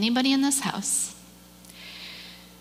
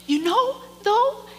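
high, tearful joy building louder; overcome with emotion; 9 semitones higher, 5 dB louder than usual. Real, not acted. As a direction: start choked and high, build to a loud affirmation. Overcome, affirming the kid's answer.

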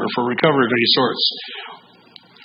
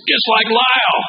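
high, loud quoted accusation; single someone out before the whole room, pitched way up; mock announcement, raised voice; retold public call-out; 11 semitones higher, 5 dB louder than usual. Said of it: "looks over and goes".

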